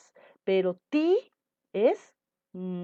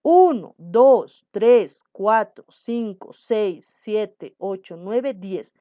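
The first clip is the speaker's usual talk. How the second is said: each number punched and separated; counting out syllables like a teacher; 8 dB louder, much slower than usual. Shown as a demonstration. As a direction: count uno dos tres out loud, one beat each.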